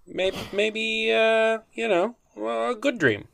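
high-pitched, halting plea; timid suggestion. Pretend. Co-host pitches good dreams after being shot down, timid and high. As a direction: offer it hesitantly, up high.

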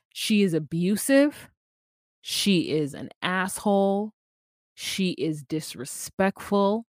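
slow, deliberate list of charges; measured, drawn-out verdicts; emphatic condemnation. Real, not acted. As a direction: spell out each charge slowly. Listing verdicts against the woman.

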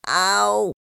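high, loud pained ow; squeaky cry of ow. A narrator's reading. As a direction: yelp as he is scrubbed. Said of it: "cried Piglet".

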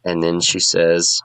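usual speaking voice

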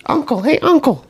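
very high-pitched mock kid voice; little kid. Little nephews calling their uncle in a game, high kid voice. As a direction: imitate a small child piping up. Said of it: "little six and seven-year-olds".

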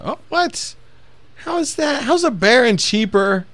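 high, slow, stunned question; shocked disbelief. Real, not acted. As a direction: voice jumps way up in shock. Shocked at the price.

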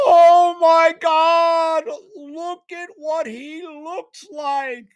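high, loud shriek, then dragging slow; mother screaming. Retold or imitated. Mother at the airport shrieking at her long-haired son. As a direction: shriek it across the airport like a horrified mom. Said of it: "She screams at the top of her lungs".

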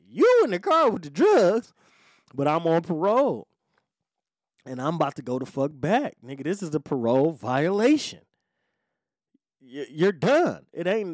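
high, loud, exasperated protest; agitated rant. Retold or imitated. Parolee realizing prison is coming, agitated rant. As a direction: hammer it out, worked up.

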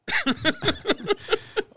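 choking cough from spicy food; coughing fit, throat burning; high strained cough. Real, not acted. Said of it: "I think I just burned the microphone".

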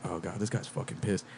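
low, hushed aside to himself; uneasy inner thought. Retold or imitated. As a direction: think it aloud very softly.

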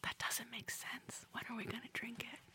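whisper. Shown as a demonstration. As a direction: whisper it like sharing a secret. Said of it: "do a whine and a whisper".

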